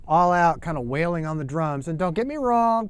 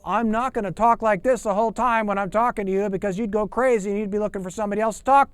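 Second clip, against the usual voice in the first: louder, higher, racing excited chatter; excited demo; 6 semitones higher, 5 dB louder than usual. Shown as a demonstration. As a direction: show how over-the-top excitement sounds. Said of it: "I'll talk like this and I'll get really excited".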